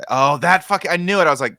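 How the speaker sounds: frustrated. Real, not acted. Realizes he missed the answer.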